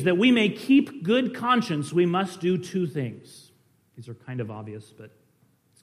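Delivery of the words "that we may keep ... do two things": higher, louder sermon reading; emphatic quote reading. A narrator's reading. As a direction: read the old quotation out to the congregation. Reads the continued quotation aloud.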